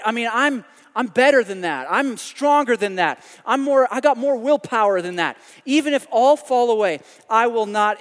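loud, emphatic self-assured boast; cocky, pumped-up declaration; boastful Peter. Acted. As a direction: pile up the boasts with rising force. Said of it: "more and more bold about how strong he is".

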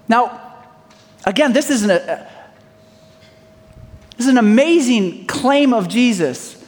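normal talking voice

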